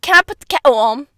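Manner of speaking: put-on British offer; light mock British accent. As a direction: ask it in an overdone British accent. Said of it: "British people".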